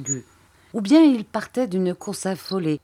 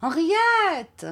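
high, drawn-out call of a name; distant call; 11 semitones higher than usual. Acted. A far-off voice calls the girl through the woods, distant call. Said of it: "Une voix éloignée cria".

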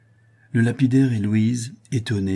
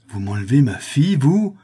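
loud outraged question leaping high on the last word; anguished, threatening outburst; furious with grief; 6 semitones higher, 7 dB louder than usual. A narrator's reading. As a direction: challenge him, wild with pain. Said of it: "furieux de douleur".

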